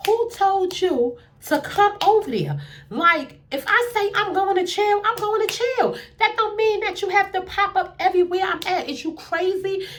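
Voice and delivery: put-on high, heated telling-off at a boyfriend; going off on him. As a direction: chew him out, fired up and indignant. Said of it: "I'm going off".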